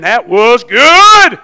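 self-satisfied. Retold or imitated. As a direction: savour every word. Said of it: "I'll say".

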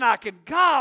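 ordinary talk

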